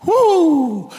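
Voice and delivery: high, loud thrilled whoo; excited whoo. Retold or imitated. Thrill of robbing a store.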